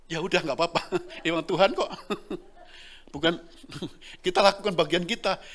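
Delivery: ordinary talk, nothing marked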